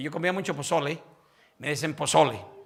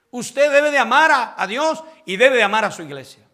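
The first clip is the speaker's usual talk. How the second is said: louder, building to a shouted declaration; full-force preaching; 5 semitones higher, 13 dB louder than usual. Acted. Pastor urging the church to love God, shouted preaching. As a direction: proclaim it with rising fire.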